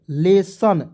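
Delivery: लेसन with a clear s in the middle; correct pronunciation. Shown as a demonstration. Teacher showing how lesson is read, demonstrating pronunciation. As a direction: say लेसन slowly, second syllable "sun". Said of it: "लेसन पढ़ेंगे".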